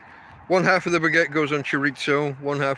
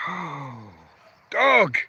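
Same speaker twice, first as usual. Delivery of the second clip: sudden startled cry of dog; startled exclamation; 2 semitones lower than usual. Real, not acted. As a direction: blurt it out in surprise.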